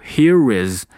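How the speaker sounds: "here is" joined with the r flowing into is; linked pronunciation. Shown as a demonstration. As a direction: slowly link "here is" as one word. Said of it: "hear is连读".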